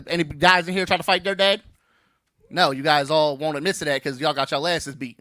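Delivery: ordinary talk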